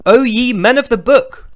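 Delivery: loud, grand mock-holy proclamation; booming scripture reading. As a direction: declaim it like a pompous preacher. Reads scripture loud, declaiming.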